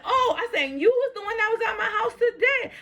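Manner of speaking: high-pitched indignant call-out; incredulous, high storyteller's accusation; retold accusation. Retold accusation of repair man.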